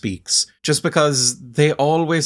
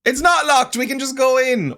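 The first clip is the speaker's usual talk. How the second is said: excited; 13 semitones higher than usual. Real, not acted. Door unlocked, second time.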